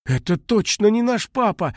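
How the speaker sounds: put-on high frightened child's cry; fearful cry. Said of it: "со страхом сказал Пых".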